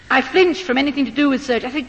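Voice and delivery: usual speaking voice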